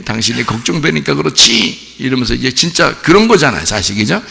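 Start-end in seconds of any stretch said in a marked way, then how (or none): 0.0-2.0 gentler acted reproach, rising at the end; softer worried wife. Worried wife to her husband, softened reproach. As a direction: tone the outcry down to tender fretting. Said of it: "더 부드럽게".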